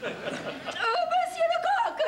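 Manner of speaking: « monsieur Lecoq » très aigu et très étiré; ton mondain. Acted. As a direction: chante le nom comme une hôtesse affectée. Présentation mondaine exagérée. (hôtesse qui présente le chef, voix aiguë et traînante)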